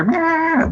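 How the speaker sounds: high squeaky cat meow; high cartoon meow. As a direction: yelp a comic meow. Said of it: "fly up on a rocket and go".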